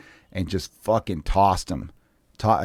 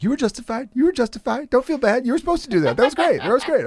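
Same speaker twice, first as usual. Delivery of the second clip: high excited women's voices, much higher pitch; girly friends' voice; 14 semitones higher than usual. Retold or imitated. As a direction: mimic the friends gushing in a high voice.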